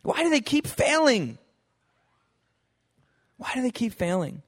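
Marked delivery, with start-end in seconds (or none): 0.0-1.4 exasperated. Retold or imitated. Repeated question, first take.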